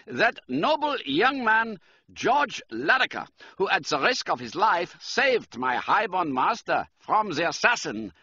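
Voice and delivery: high, urgent German count; emphatic German-accented appeal. A narrator's reading. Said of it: "He spoke with a German accent".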